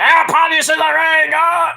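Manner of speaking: hammed-up excited shout; crazy excited. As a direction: go wild like a hyped commentator. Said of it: "Pat McAfee's like, going crazy".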